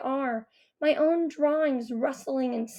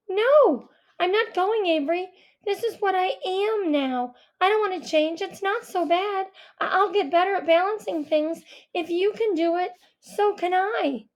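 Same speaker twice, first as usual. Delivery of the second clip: high, louder, insistent protest; upset refusal; 6 semitones higher, 3 dB louder than usual. Acted. Owl refusing to go with Avery, high and insistent. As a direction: push back at him, voice climbing.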